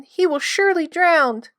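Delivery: sobbing. A narrator's reading.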